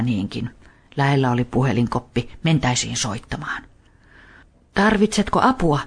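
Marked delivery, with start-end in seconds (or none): 4.7-5.8 put-on lightly raised boy's offer; a boy offering help, a bit higher; helpful boy. A narrator's reading. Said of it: "Niko kysyi".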